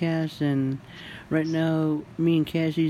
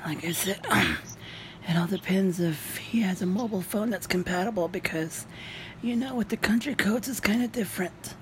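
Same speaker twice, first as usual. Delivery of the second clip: whispering; 4 semitones higher, 4 dB quieter than usual. Real, not acted. Whispered explanation.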